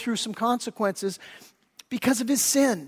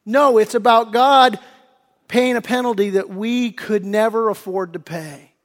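normal talking voice

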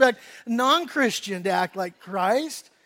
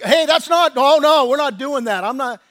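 mock prissy outrage; 3 semitones higher, 11 dB louder than usual. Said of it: "did I get all like".

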